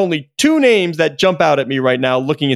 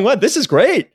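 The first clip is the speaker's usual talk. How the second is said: higher, mock-delighted scheming voice; George Kliavkoff gloating; 6 semitones higher than usual. Host imitating a gleeful conference commissioner, higher mock delight. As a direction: play a commissioner pleased with himself. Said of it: "George Kliavkoff is going".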